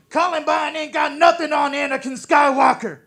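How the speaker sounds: high, loud yelled punchline; shouted punchline. Acted. Comic yelling the joke at the crowd, loud. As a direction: belt it out at the crowd.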